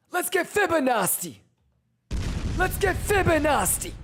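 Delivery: high, loud hype-man yelling at chat; screaming celebration, very high and loud; hype shouting. Real, not acted. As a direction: pump up the chat like a crowd. Hyping up chat mid-trade.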